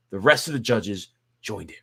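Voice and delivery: plain reading voice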